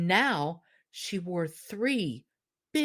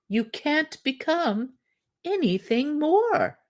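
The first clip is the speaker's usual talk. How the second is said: pleading husband; 9 semitones higher, 5 dB louder than usual. Acted. Husband urges contentment.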